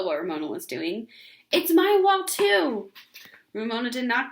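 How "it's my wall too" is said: much higher, louder defiant retort. Acted. Ramona to Beezus, defiant shout. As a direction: throw it back at her.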